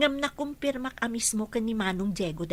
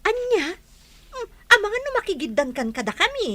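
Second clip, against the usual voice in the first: high, loud, quick startled questions; rushed astonished outburst; surprised; 10 semitones higher, 3 dB louder than usual. Acted. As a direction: blurt it out in shock. Hearing friend will marry first.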